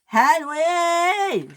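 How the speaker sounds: high, drawn-out yell; shout. A narrator's reading. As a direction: yell the name in exasperation. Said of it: "shouted James".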